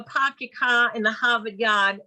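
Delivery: New England "pahk the cah" accent; Northern Boston accent. Shown as a demonstration. Trainer showing accents, Northern Boston. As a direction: drop the r's like a Bostonian. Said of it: "Northern accent".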